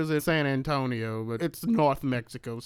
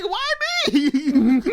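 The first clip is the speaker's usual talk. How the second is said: high, whiny laughing why me; mock whine; 13 semitones higher, 6 dB louder than usual. Host playing Reese afraid to go upstairs, whining high. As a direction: whine it like a scared sidekick.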